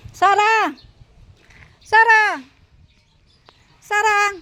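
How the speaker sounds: loud, high call across the yard; shouting a dog's name to come eat. Real, not acted. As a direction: call the hiding dog from a distance. Calling shy dog from afar.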